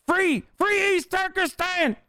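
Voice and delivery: very high, slow parody voice; mock the attacker in a squeaky drawl; mocking hypocrite. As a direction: squeal it like a self-righteous bully. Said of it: "That's what they're doing".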